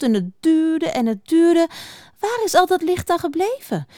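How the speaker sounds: high-pitched, agitated little field mouse; veldmuis, opgewonden. Acted. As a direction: plead as an excited mouse. Veldmuis als figuur in verhaal. (hoge, opgewonden muizenstem)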